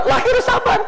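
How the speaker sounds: high squeaky newborn voice; high baby-like voice. Pretend. Baby voice version.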